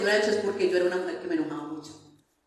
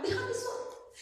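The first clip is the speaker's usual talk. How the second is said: much higher, pleading "déjame solo"; 8 dB quieter than usual. Retold or imitated. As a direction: push "déjame solo" up high, begging to be left alone. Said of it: "Les digo".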